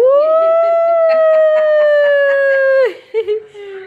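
long high loud whooping laugh; long delighted whoop. Real, not acted. Dad cheering his baby's costume, long whooping laugh.

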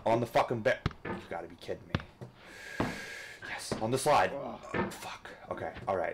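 usual speaking voice

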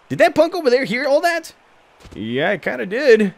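squeaky thug realizing he was overheard; goon voices. Acted. Goons notice the player.

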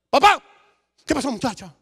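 acted urgent shout; imitated excited call. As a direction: burst out calling for papá. Daughter calls out on Skype.

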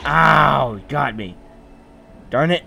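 loud pained yelp; yelling. Real, not acted. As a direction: yell out at being hit. Shot in game, yelling.

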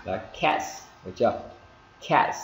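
"Cats" with a crisp T into a hissed S; emphatic "Cats", audible hiss after the T; sounded final S. Shown as a demonstration. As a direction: release "ts" clearly at the end of "Cats". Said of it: "âm S thì chúng ta phải bắt buộc".

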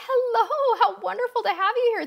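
high, laughing hello of delight. Real, not acted. Host greets a viewer from India, high and laughing. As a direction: beam a welcome to a faraway guest.

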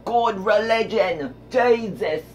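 hammed-up English colonist voice; cartoonish English accent, slow on good religion. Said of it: "they're like".

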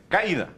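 "caída" with A and I joined in one syllable, CAI-da; wrongly run together. Shown as a demonstration. Teacher showing what it is not, "caída" run together as CAI-da. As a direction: glide "caída" into a diphthong, stressed CAI. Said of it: "não é junto".

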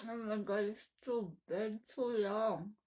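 talking while biting food; sausage in mouth. Real, not acted. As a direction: lips around sausage. Seen 1: Sausage on skewer pressed to lips, bitten throughout the labelled words.

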